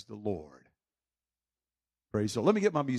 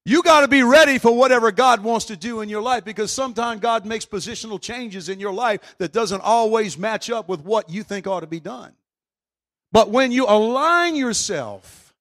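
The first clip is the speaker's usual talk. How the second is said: loud, higher preacher's fire; full-force preaching; 7 semitones higher, 10 dB louder than usual. Acted. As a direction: preach it hard to the crowd.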